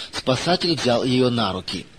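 ordinary delivery, nothing marked

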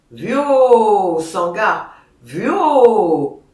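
loud herder's cry; shouting at the bull. A narrator's reading. Boss yelling at the raging bull, loud call. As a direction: drive the bull off with a yell. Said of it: "faisait le pelot".

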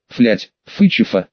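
talking through a smashed nose; words muffled by a bleeding nose; broken nose. Acted. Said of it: "зажимая разбитый нос ладонью". (говорит с разбитым носом)

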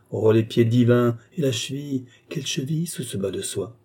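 ordinary delivery, nothing marked

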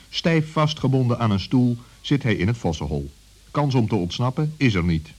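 plain reading voice

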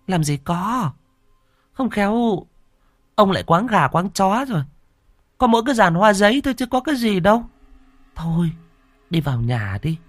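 put-on high, fast nagging old woman voice, pitched well up; scolding wife. Wife nags husband to come indoors, high and dismissive. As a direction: brush off his ghost talk, shoo him inside.

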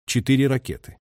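ordinary delivery, nothing marked

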